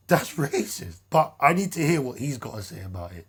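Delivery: usual speaking voice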